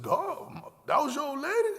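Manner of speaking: put-on can't believe it was his girl; shocked question. Asking Flo if the dancer was his woman, shocked. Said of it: "I said".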